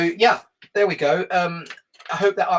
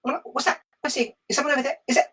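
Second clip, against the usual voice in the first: high, jittery nervous meerkat voice; 10 semitones higher, much faster than usual. Acted. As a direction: squeak it out like a skittish little meerkat. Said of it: "I think he's gonna be quite nervous like that".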